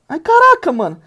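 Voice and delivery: sudden high yelp of surprise; surprised shout. Real, not acted. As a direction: caught off guard, loud. Surpreso com lance do jogo. (grito de surpresa)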